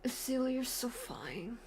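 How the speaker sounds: slow, dreamy flirting voice; a teasing lovestruck coo; mock flirt. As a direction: swoon over Silu, slowly. Streamer voicing a flirt at Silu.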